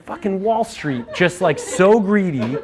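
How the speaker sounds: high, slow, half-laughing scorn; laughing bitter rant. Retold or imitated. Ranting about greedy Wall Street bankers, slow and half-laughing. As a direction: drag out the contempt through a laugh. Angry retold outburst at crisis.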